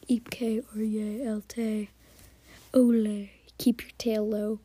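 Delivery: slow, whispered Pig Latin. Shown as a demonstration. Podcaster imagines cats whispering orders in Pig Latin, slow. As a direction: breathe the Pig Latin words out slowly. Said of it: "It would be so hard to communicate".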